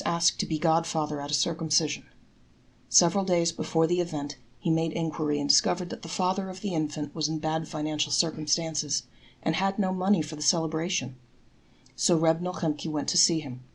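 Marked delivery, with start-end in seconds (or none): none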